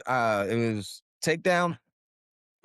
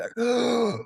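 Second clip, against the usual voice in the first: big gasp for air; 10 semitones higher than usual. Retold or imitated. Diver finally surfacing after a belly smack, big high gasp. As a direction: imitate surfacing with a huge gasp. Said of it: "I'm able to take a breath".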